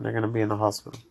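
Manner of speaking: usual speaking voice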